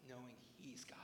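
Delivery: a soft breathy whisper. Acted. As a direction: let it fall to a hushed whisper. Closing thought on rest.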